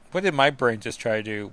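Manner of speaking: normal talking voice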